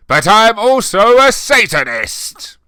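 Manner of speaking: loud, high, slow proud reveal; angry declaration. A narrator's reading. Hagrid angrily revealing to Snape he is a Satanist, loud. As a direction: announce it with mock fury. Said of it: "paused angrily".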